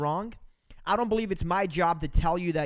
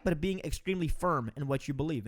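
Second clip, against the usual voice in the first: ordinary talk, nothing marked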